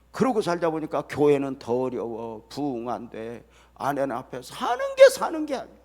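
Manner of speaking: usual speaking voice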